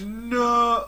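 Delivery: high, loud fake shock; mock horrified cry. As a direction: yell it in mock horror. Mock horror reaction.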